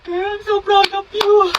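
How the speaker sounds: high slow voice. Acted. High voiced friend in skit.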